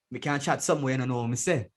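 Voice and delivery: thick Jamaican patois, hard to understand. Shown as a demonstration. Guest showing the host how patois differs from accent, thick Jamaican patois. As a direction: switch fully into Jamaican patois. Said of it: "But then there's patois".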